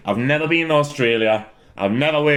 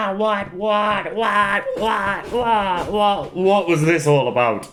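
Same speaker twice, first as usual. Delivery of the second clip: laughing disbelief; 8 semitones higher, slower than usual. Real, not acted. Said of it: "I'm laughing too hard".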